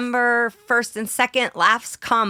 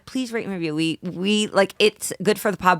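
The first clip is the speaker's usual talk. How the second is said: ordinary talk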